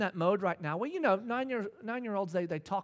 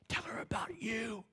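slow, soft whispered nudge; God nudging the father, whispered and slow; gentle inner whisper; 4 semitones higher, 6 dB quieter than usual. Retold or imitated. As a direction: whisper it like God's quiet prompt. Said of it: "God goes".

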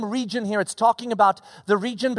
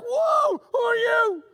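much higher, startled cry; startled shepherds; 13 semitones higher, slower than usual. Pretend. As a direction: jump back in shock at the glowing stranger. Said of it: "And they're like".

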